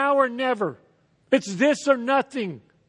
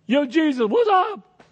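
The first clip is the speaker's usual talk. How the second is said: breezy, high and loud, like hailing a buddy; jokey street greeting to Jesus; casual mock greeting; 7 semitones higher, 4 dB louder than usual. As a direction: toss it off like a casual "what's up". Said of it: "You think that's what they're doing?".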